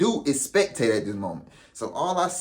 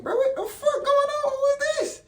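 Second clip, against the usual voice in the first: very high, laughing disbelief; shocked while getting choked, half laughing; high, strained what-is-happening; incredulous. Retold or imitated. Being choked by coordinator.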